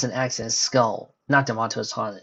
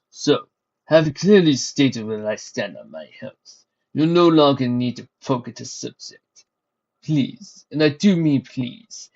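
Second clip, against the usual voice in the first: menacing threat; 7 dB louder than usual. Acted. Threatening the servant.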